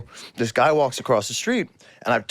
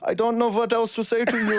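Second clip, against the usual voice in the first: put-on higher comic German accent; 7 semitones higher than usual. Run out of things to tell the stranger, German accent. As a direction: confess helplessly in a German accent. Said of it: "keep this charade on".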